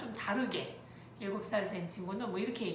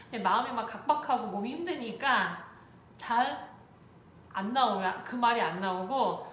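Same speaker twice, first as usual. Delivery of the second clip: usual speaking voice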